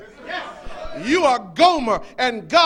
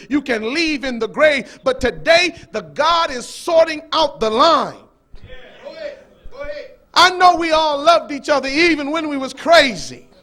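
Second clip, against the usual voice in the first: preaching at full force; 5 dB louder than usual. Acted. Preacher warning the congregation, at full force. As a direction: hammer each phrase home.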